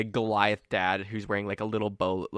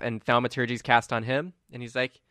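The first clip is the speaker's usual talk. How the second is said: ordinary delivery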